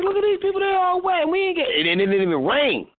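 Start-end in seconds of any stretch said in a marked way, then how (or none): 0.0-1.7 high, incredulous quoted voice; retold amazement. Host quoting himself watching wet crowds, high incredulous. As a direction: act out his younger self gawking, pitched high. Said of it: "And I was like".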